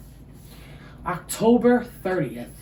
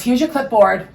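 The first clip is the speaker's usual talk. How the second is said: mock-chirpy front desk voice; bubbly receptionist; 6 dB louder than usual. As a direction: hand over the clipboard like serving ice cream. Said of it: "And he goes".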